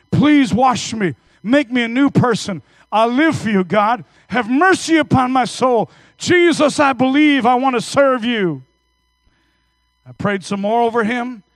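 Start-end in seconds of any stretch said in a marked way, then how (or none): 0.1-8.6 preacher voicing a sinner's prayer, loud and pleading; fervent prayer. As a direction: cry out to God for mercy. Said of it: "Just say, 'Jesus".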